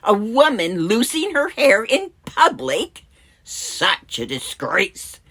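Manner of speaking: high, loud outrage slowing into heavy scorn; scandalized disapproval. Acted. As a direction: voice the shocked, judging onlookers. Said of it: "what Simon and the others were thinking".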